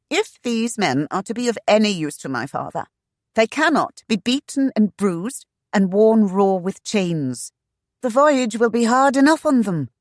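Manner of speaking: loud, high, heated scolding; heated rebuke. A narrator's reading. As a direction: scold him hotly. Lady berates agent.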